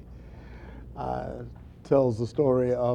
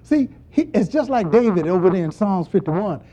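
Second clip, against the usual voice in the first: ordinary delivery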